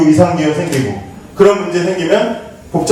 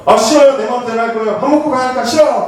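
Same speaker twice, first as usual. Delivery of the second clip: put-on defiant teen; 6 semitones higher than usual. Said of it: "그러면서".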